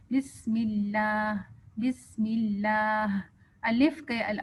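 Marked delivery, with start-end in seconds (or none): 0.1-3.2 slow بسم اللہ with the آ after the لام drawn out; stretched alif. Shown as a demonstration. Teacher modelling the long alif slowly. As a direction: lengthen the vowel in اللہ. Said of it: "اللہ کا لام لمبا کرنا ہے".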